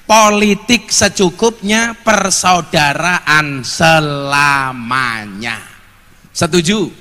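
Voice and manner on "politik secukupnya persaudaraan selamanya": emphatic maxim. Real, not acted. Preacher stating his principle on politics to the congregation, slow emphatic maxim. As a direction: stretch out the final words for weight. Solemn principle, drawn out for effect.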